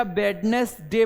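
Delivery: वेडनेसडे as three parts, 'wed-nes-day'; wrong, D pronounced. Shown as a demonstration. Teacher quoting pupils' mistake, वेडनेसडे as 'wed-nes-day'. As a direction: sound the D before N in वेडनेसडे. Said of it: "गलत है".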